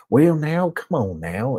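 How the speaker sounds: exaggerated Southern twang, leaping high on the first words; hammed-up Southern accent. Shown as a demonstration. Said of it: "but I'm hamming it up".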